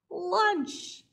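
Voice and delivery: high, greedy crow voice; hungry crow. Acted. Hungry crow eyeing the snail, high and greedy. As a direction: claim the snail as lunch. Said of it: "said a hungry crow".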